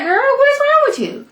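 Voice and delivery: exasperated disbelief. Real, not acted. Vlogger yelling at a gullible character, exasperated disbelief. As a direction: throw it at her like she's lost her mind. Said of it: "I'm like".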